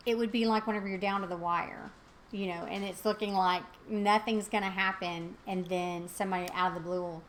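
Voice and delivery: normal talking voice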